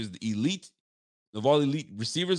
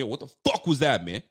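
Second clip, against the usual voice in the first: imitating a coach chewing out; 3 semitones higher, 4 dB louder than usual. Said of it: "Are you actually gonna tell me".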